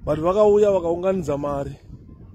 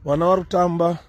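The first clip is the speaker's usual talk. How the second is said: ordinary delivery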